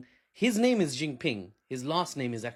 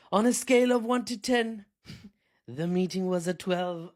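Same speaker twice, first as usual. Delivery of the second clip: higher, louder mock Trump boast; hammed-up imitation of a bragging president; imitating Trump; 6 semitones higher, 5 dB louder than usual. Quoting Trump on the meeting, hammed-up boast. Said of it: "he said".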